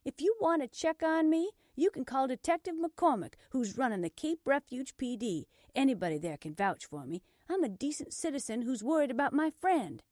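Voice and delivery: high, loud self-defence; defiant insistence. Acted. Blair justifying herself to the officer, defiant. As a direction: argue it out indignantly.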